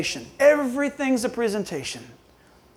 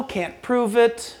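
ordinary talk

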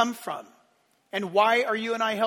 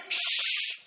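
shush. Pretend. Hushing the crowd.

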